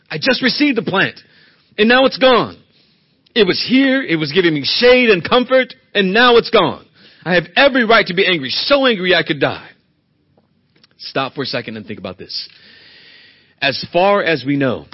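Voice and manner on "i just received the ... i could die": builds from raised to loud, higher indignation; rising angry complaint. Acted. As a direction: whine louder and louder over the lost plant. Jonah's complaint voiced, rising.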